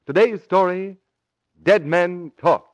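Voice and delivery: ordinary talk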